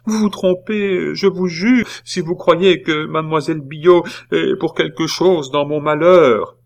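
high, urgent pleading; beseeching. A narrator's reading. Nephew pleading his innocence to his aunt, beseeching. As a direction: swear it in an imploring voice. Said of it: "lui dit-il en joignant les mains".

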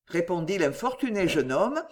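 plain reading voice

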